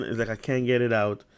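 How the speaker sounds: usual speaking voice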